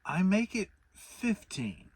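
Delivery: a slowed, thoughtful Pooh; working out a sum aloud; Pooh, thinking hard. A narrator's reading. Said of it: "frowning very hard".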